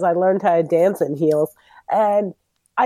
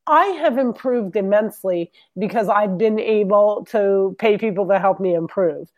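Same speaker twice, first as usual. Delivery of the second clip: ordinary delivery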